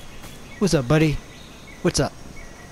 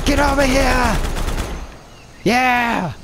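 taunting shout; 7 semitones higher, slower than usual. Real, not acted. Gamer taunting an opponent, challenging shout. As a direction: call the enemy out. Charging an enemy.

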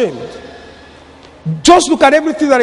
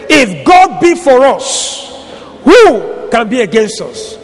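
slow, deliberate proclamation of the verse; said very clearly; slower than usual. Real, not acted. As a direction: lay each word down slowly and firmly, like a preacher declaring it. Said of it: "And I say it very clearly".

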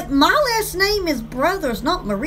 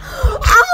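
surprised gasp; 6 semitones higher, 3 dB louder than usual. Real, not acted. Sudden gasp.

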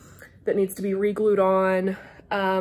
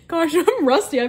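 laughing; 7 semitones higher, 5 dB louder than usual. Real, not acted. Laughs at her own intro.

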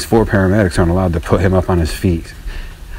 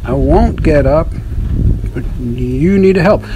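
higher, slow pitiful plea; mock helpless caller; 7 semitones higher, slower than usual. Showing the neighbor how to ask for help, slow and whiny. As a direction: plead slowly like a fallen man. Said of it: "Be specific".

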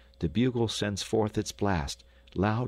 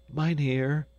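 higher-pitched mild plea; understated plea, pitched up; husky pleading; 7 semitones higher than usual. A narrator's reading. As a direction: call out to him, gently pleading. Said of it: "pleaded Hans in a husky voice".

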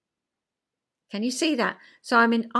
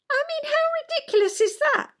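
incredulous; faster than usual. Real, not acted. Amused at husband's excuse.

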